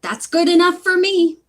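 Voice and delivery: high, decided character voice; insistent wife. A narrator's reading. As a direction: settle the matter insistently. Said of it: "insisted his wife".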